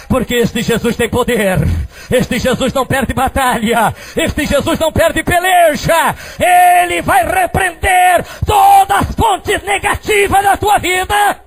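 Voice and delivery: building to a high, drawn-out shout; full-force preaching. Acted. Preacher promising Jesus' power to listeners, preaching rising to a shout. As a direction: climb phrase by phrase to a shouted peak.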